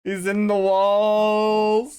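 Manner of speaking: slow, high, loud haunting wail; creepy drawn-out wail. Acted. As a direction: stretch it like a ghost.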